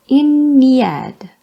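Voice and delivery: big, stretched demonstration of "Iniad"; drawn-out pronunciation. Shown as a demonstration. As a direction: sound out "Iniad" emphatically, syllables drawn long. Tries out the god's name.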